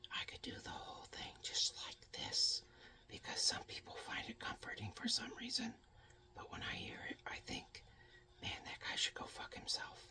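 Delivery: soft, fully whispered ASMR demo. Shown as a demonstration. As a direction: whisper close and hushed, mock-soothing. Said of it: "I could do an A S M R".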